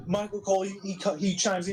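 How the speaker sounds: ordinary delivery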